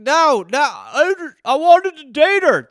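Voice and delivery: high, loud, hammed-up wail; distraught man-child. Grown son loved the Tooth Fairy, distraught. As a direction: protest like a heartbroken kid.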